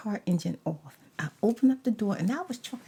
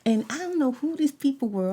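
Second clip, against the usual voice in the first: ordinary talk, nothing marked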